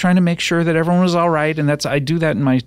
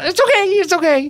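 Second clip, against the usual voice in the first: very high, put-on reassurance; sugary mock forgiveness. Host waving away the guest's apology, high and over-sweet. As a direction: coo it far up in pitch.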